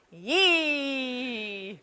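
"Yee." said through a wide grin; smiling yee. Shown as a demonstration. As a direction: stretch the lips wide on "Yee.". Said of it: "with a big smile, say".

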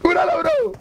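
very high squealed plea; desperate plea. Real, not acted. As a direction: squeal it out, begging. Begging again for the heal.

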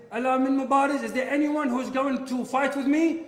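loud, high challenging call; a warrior shouting for an opponent; battle challenge. Retold or imitated. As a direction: call out across the battlefield daring anyone. Enemy champion's battle challenge.

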